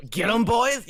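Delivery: loud, high shout to henchmen; battle command. Acted. Captain re-enacts setting his minions on them, loud high shout. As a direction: yell the order to attack. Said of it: "You mean when I said".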